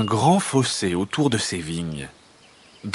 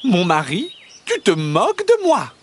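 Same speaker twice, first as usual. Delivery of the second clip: high-pitched peasant wife, put out; shrilly raised, offended protest; indignant wife; 9 semitones higher, 4 dB louder than usual. A narrator's reading. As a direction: reproach your husband in a high voice. Wife indignantly reproaches her husband.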